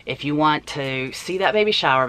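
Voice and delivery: usual speaking voice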